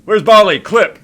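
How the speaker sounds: high, loud urgent calls; excited alarm. Real, not acted. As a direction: yell the alerts in a rush. Sudden panic during mission.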